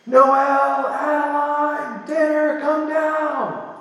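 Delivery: put-on high sing-song child's call up the stairs; little girl calling. Daughter calling her sisters down, high sing-song child voice. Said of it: "she just yells up".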